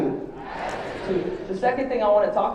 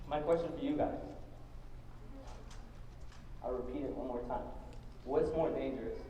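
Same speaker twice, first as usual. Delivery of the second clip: holding back tears; 4 semitones lower than usual. Real, not acted. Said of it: "I'm gonna try not to cry again".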